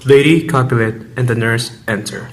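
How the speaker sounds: ordinary delivery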